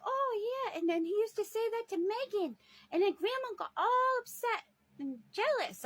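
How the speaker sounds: mimicking the childlike grandmother's chatter; imitating grandma. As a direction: play her like an excited little girl. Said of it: "She's like".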